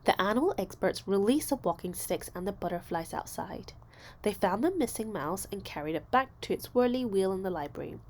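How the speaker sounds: usual speaking voice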